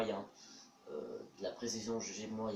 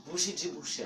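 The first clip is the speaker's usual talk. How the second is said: talking through a blocked nose; stuffed-up nose, sick voice; 6 semitones higher, 4 dB louder than usual. Real, not acted. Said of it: "j'ai le nez bouché".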